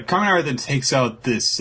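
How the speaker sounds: ordinary talk, nothing marked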